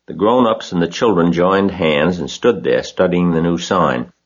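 ordinary delivery, nothing marked

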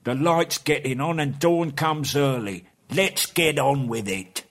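troll fearing the dawn; urging troll. A narrator's reading. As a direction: hurry the others like a troll.

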